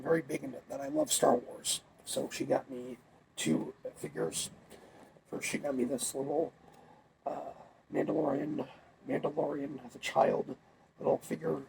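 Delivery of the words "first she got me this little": usual speaking voice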